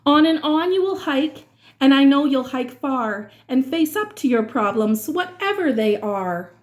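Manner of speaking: usual speaking voice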